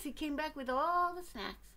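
ordinary delivery, nothing marked

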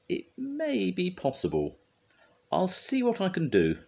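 high, considering lady judge; judge's character voice. A narrator's reading. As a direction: give a tentative yes in a high voice. Missus Percival considers the request.

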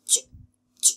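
"จ-" as a voiceless hissed ch, no vowel; isolated J sound. Shown as a demonstration. Teacher shows the final sound, breath only. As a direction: release จ- as pure friction behind the teeth. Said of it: "แล้วออกเสียง".

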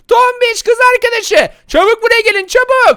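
yüksek, tiz, yüksek sesle çağrı; seslenme. Acted. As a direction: uzaktakilere bağırarak seslen. Tuzağa çağırıyor. (yüksek sesle çağırış)

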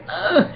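high groan of disgust; a pained, high "ugh". Retold or imitated. Groaning at the dentist's jab. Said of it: "and I'm like".

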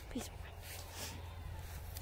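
soft slow whisper, barely voiced; whispered plea. Real, not acted. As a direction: breathe it out slowly, pleading. Same plea, quieter take.

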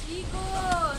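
loud call to the team; shouting. Real, not acted. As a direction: yell for your teammates. Calling teammates mid-fight.

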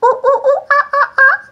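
very high loud monkey hoots; hammed-up monkey ooh-ooh-ah-ah; monkey sounds. Shown as a demonstration. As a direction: hoot like a monkey for the class. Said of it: "Can you do a monkey sound?".

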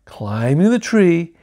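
plain reading voice

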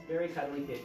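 plain reading voice

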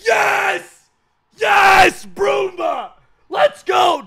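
loud, high cheer; excited shout. Real, not acted. Gamer cheering a great pack pull, excited.

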